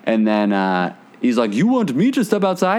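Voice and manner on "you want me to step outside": high-pitched, put-on confrontation; imitated indignant challenge. Retold Russian guy answers the challenge.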